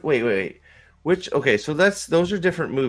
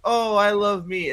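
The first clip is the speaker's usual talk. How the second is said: higher, slow mock-sentimental voice; touched Riley; 6 semitones higher than usual. Riley realizing who Joy is, mock-touched. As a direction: draw it out in hammed-up self-love. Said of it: "And then she's like".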